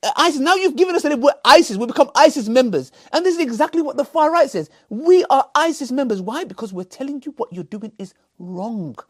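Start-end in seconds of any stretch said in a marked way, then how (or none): 0.0-6.2 indignant. Real, not acted. Indignant rant at Adam.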